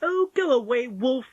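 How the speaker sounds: put-on high, loud child's voice; Polly, dismissive little girl. A narrator's reading. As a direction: send the wolf off firmly.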